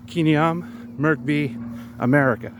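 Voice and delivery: slow Khmer answer, "America" said the American way; role-play answer. Acted. Learner answering in role play, slow careful Khmer. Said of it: "I could say something like".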